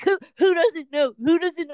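high, loud, excited demand; eager dad. Retold or imitated. Dad wanting someone else to prank, high and eager. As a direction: burst out hungry for the next victim. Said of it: "so then he's like".

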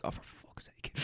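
whispered under the breath; whispered curse. Retold or imitated. Embarrassed customer cursing to himself in a whisper. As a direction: mutter it unvoiced, embarrassed. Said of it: "I was like".

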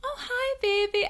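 put-on high baby-talk hello. Shown as a demonstration. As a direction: coo up an octave at a baby. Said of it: "if I go up an octave".

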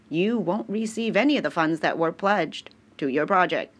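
ordinary talk, nothing marked

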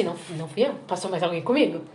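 mock sheepish murmur; guilty mumble. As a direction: mumble your innocence under your breath.

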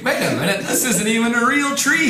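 high, loud mock realization through laughter; loud exclamation. Game master voices the player running through the illusory tree, loud and laughing. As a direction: blurt it out loudly, half laughing. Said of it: "he says loudly".

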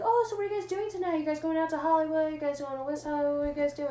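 high, quick run of eager questions; imitating eager guy. Guy asking friends about tonight's plans, eager imitation. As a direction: pester them in a high, put-on voice. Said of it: "he was like".

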